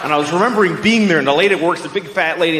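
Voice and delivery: usual speaking voice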